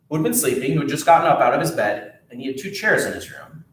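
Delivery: ordinary delivery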